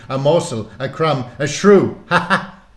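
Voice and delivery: put-on high, loud, theatrical old-world wizard; boastful wizard relishing each item, ending in a laugh; wizard Murdin. A narrator's reading. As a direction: declaim it grandly, then laugh.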